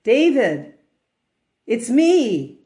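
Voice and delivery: lightly voiced booming God; a hint of a divine voice calling down; God answers the falling man from heaven. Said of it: "a voice comes down from heaven and says".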